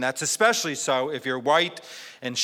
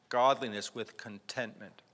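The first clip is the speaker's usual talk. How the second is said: soft repeat; 4 semitones lower, 7 dB quieter than usual. Real, not acted. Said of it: "Now, godliness with contentment".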